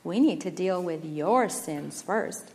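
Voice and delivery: low, slow, quiet rebuke; hushed, grave reply; spoke softly. A narrator's reading. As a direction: correct him gently and unhurriedly. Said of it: "Firstborn spoke softly".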